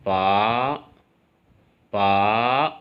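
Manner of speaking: पा dipping then climbing; पा in Mandarin third tone; falling-rising third tone. Shown as a demonstration. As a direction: dip पा down then lift it. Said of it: "B ए की sound बनेगी".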